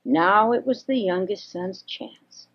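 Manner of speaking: usual speaking voice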